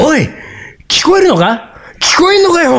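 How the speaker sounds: imitating an overbearing husband, pitch rising; mildly acted bossy demand; mock irritated yell. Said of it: "声色を一段、二段上げて、怒鳴って".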